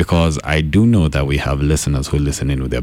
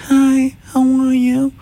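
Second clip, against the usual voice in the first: put-on very high, slow baby voice; slower than usual. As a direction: squeak it like a little baby. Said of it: "she speaks like a baby".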